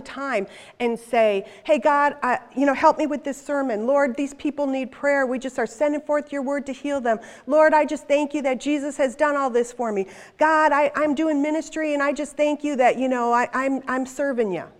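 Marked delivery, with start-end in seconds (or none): 1.6-2.2 loud, higher call to God; busy, routine prayer. Retold or imitated. Acts out her usual busy prayers. 2.2-14.6 louder, higher run-on praying; mock busy minister calling on God; busy, routine prayer. Acts out her usual busy prayers.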